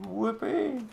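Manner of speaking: high, hollow fake cheer; sarcastic whoopee. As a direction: cheer without meaning it. Mocking poison resistance stat.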